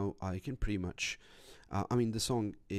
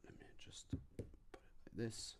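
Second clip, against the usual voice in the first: whispering; 4 semitones higher, 16 dB quieter than usual. Real, not acted. Quiet aside while checking phone.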